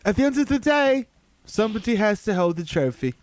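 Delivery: mock British accent; John Oliver. Comic imitating a British winner accepting the trophy, wild British accent. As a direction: put on an exaggerated posh-host British delivery. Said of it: "John Oliver saying it in a wild British accent".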